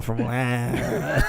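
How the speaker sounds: a put-on Cajun accent, barely a word; mock Cajun mumble. Answering where he's from, a mumbled mock Cajun accent. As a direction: mumble Wine like a bayou local. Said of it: "You just made a sound at me".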